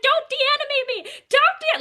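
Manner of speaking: high, loud frantic puppet plea; panicked puppet. Retold or imitated. Puppet protesting when a kid pulls it off, frantic high plea. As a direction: beg not to be taken off the hand. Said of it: "the puppet's like".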